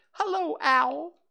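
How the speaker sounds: put-on very high squeaky baby kangaroo; squeaky little Roo. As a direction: squeak it out like an excited little joey. Roo's voice.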